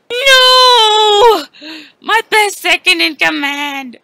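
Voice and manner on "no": anguished cry. Acted. Despair at teammate's loss.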